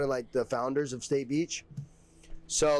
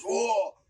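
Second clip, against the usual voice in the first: mock angry roar; 8 semitones higher, 4 dB louder than usual. Boyfriend roaring before punching back.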